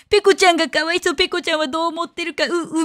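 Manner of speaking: high, whimpering mock pity for Peko; mocking whiny netizen. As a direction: parody a fake-sobbing online busybody. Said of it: "みたいな感じで".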